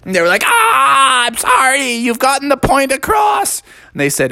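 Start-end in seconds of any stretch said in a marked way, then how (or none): 0.5-3.6 high mock-pained cry; hammed-up wailing. Stung players surrendering to bees, wailing.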